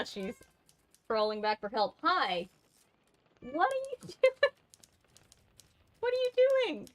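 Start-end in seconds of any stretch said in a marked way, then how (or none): 3.4-6.9 very high, squeaky questioning; high-pitched pet talk. Real, not acted. Streamer questioning the pet crawling back for help, squeaky high. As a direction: ask it way up high with a laugh.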